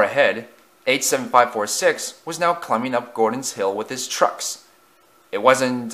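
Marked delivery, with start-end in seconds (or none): none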